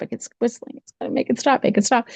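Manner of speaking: high, acted child's plea; frightened pleading. Girl begs mother to stop whistling.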